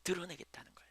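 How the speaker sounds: hushed, breathy whisper of the point. Real, not acted. Preacher whispering the key line to the congregation. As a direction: lean in and whisper it.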